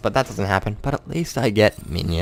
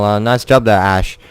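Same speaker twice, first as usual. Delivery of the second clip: mock praise; 7 dB louder than usual. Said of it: "and we're like".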